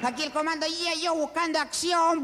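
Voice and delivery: high, far-carrying hero call; action-hero commando. Acted. Motorbike cop radioing as a commando, high heroic call. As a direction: declaim it high, like a cartoon commando.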